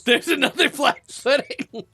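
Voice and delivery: high-pitched, laughing announcement. Real, not acted. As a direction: break the bad news through real laughter. Game master laughs while announcing another monster.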